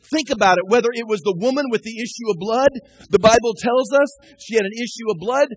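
usual speaking voice

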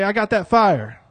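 excited. Shown as a demonstration. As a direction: shout "fire" with excitement. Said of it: "we should be excited".

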